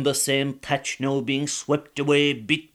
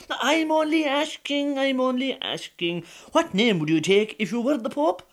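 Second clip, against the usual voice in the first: lightly raised wheedling voice; sweet-talking him innocently; a hint of a scheming wife wheedling; coaxing wife; 10 semitones higher, 3 dB louder than usual. Wife coaxing her husband.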